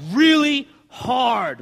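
loud, drawn-out, weighty emphasis; heavy emphasis. Acted. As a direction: lean hard and slow on each word. Mimics demanding legalistic advice.

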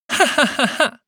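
shrill, loud cruel laugh; a short evil cackle of triumph; cruel high laugh. Acted. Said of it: "Saba entfuhr ein hohes, grausames Lachen".

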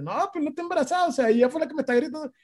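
high, rapid protest; retold self-defence. (defensa aguda y atropellada, imitada)

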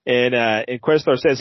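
ordinary talk, nothing marked

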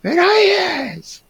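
high, slow, shrieked scolding; mock teacher yell. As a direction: shriek it like a furious headmistress. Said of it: "me gritó".

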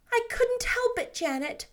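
sheepish apology; repentant. A narrator's reading. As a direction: own up with regret. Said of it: "said Anne repentantly".

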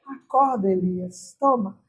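hushed, low coaxing of a sleeper; gentle waking voice. Retold or imitated.

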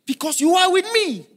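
soaring preacher cry; preached high. Real, not acted. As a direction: lift it up to the congregation. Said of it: "preached climax".

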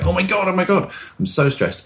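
fast, high, panicky mock fretting; panicked high pitch. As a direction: act out an anxious person spiralling. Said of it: "when we're stressed".

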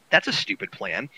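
normal talking voice

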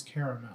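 "caramel" as CARE-uh-mel, softly; fancy pronunciation. Shown as a demonstration. Showing friends the fancy way, "caramel" as CARE-uh-mel. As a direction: quietly give "caramel" all three syllables. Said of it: "If I'm trying to act fancy".

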